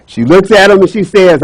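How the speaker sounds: plain reading voice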